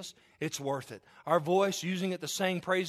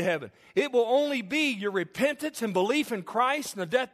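raised, driving sermon voice; preaching at full force; 4 semitones higher, 4 dB louder than usual. Acted. Preacher warning the congregation, raised and driving. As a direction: hammer the point home.

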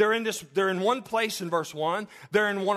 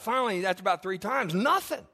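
ordinary talk, nothing marked

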